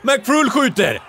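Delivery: stretched, high, loud yell; excited shout. Real, not acted. Gamer yelling as his player shoots, loud high shout. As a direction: scream the shot.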